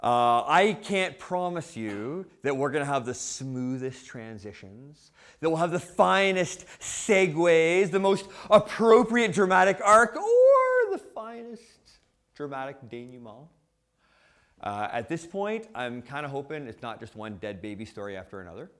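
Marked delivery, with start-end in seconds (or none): 2.4-13.5 mock-grandiose. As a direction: build to a loud, high theatrical flourish. Hammed-up list of theatrical promises.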